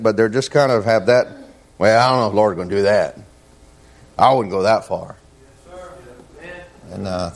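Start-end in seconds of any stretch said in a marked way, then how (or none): 1.8-5.2 mildly imitated skeptic; doubting attitude. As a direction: play a half-sarcastic doubter, only lightly voiced. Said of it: "have a kind of attitude".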